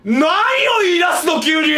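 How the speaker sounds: loud startled shout; sudden outraged yell. Real, not acted. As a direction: yell it out in disbelief. Streamer yells at game character.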